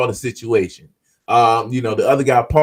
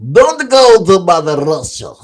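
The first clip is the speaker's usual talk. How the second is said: higher, louder mock Russian accent; 6 semitones higher, 8 dB louder than usual. Warning listeners off Mother Russia in a put-on Russian accent. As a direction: boom it out in a hammed-up Russian accent. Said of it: "Russians sound like that to me".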